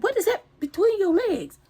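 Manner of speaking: high, alarmed question; retold high-pitched disbelief; shocked question. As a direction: demand it in horror. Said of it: "I said".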